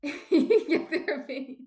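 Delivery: loud, high burst of laughter through the words; laughing. Real, not acted. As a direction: laugh hard while getting the words out. Laughs at own bad impression.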